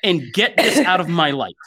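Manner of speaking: high, hammed-up exasperated rant; mock fed-up demand. Imagined Potter actors pleading with the studio, mock exasperated. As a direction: build to a theatrical final demand. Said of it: "and they were like".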